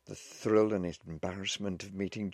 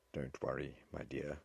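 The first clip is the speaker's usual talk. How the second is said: low, soft, soothing voice; calm her gently and quietly; reassuring; 4 semitones lower, 8 dB quieter than usual. A narrator's reading. Officer sitting beside the frightened young wife, softly reassuring.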